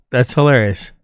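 normal talking voice

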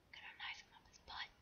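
hushed, scheming whisper; sneaky whisper. Real, not acted.

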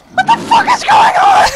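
screaming in panic; panicked shout. Real, not acted. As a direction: yell it out in total panic. Panicked zombie chase.

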